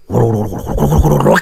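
rolling thunder. A narrator's reading. Thunder rumbling in the storm.

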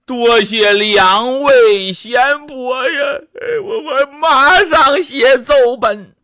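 带着哭腔，声音发颤抬高; 泪流满面地道谢; 含泪哭诉. Acted. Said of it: "语泪千行". (哭着说话，音调偏高)

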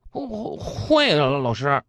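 slow, stammering timid schoolboy; nervous, stuttered reply. Acted. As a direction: answer the teacher haltingly, afraid to be wrong. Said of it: "男同学怯生生地回答".

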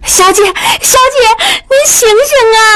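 very high-pitched, urgent plea; panicked maid. A narrator's reading. Maid calling to her fainted young mistress, frantic. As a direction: cry out to rouse her.

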